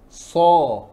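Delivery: So said heavy with an open mouth. Shown as a demonstration. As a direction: voice So full and rounded from the back. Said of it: "Sebagaimana tadi".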